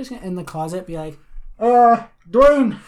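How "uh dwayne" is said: high, loud mock host call. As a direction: call to the guest from a distance. Pretends calling from the closet.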